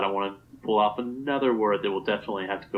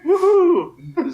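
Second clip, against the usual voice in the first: excited cheer; 8 dB louder than usual. Real, not acted. Celebrating troll level.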